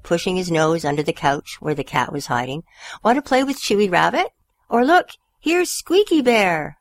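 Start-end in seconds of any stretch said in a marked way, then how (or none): 3.0-6.8 higher eager coaxing; coaxing dog talk. A narrator's reading. Stanley offering toys to the hiding cat, higher coaxing. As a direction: tempt her out with toys. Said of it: "he said it in dog talk".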